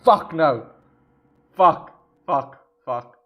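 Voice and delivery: loud panicked curses; real shock, shouting; frightened swearing. Real, not acted. Reacting to a jump scare.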